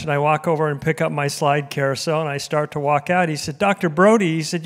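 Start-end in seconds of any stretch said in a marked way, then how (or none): 3.6-4.4 calling out. Retold or imitated. Lone man calls departing speaker back.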